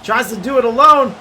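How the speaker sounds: fast, rising sports commentary; live play-by-play. Acted. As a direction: call the game as it happens.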